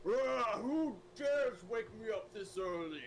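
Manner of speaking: booming, high, slow "who dares" of an angered deity; sleeping ancient god. Acted. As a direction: roar it like a god rudely woken. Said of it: "I'm gonna be like".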